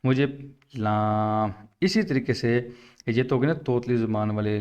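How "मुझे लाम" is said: very slow, stretched लाम; slow teaching prompt of लाम; drawn-out answer. Shown as a demonstration. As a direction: draw out the word लाम slowly. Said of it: "क्या बन गया बेटा".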